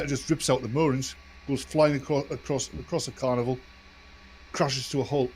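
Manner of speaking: ordinary talk, nothing marked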